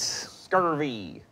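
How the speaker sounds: pirate voice. Pretend. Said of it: "talk like a pirate from now on".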